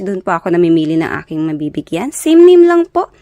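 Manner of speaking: loud, bright sign-off to viewers, rallying subscribers; energetic outro shout-out; loud vlog outro. Acted. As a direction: pump up the channel plug like a cheerful host.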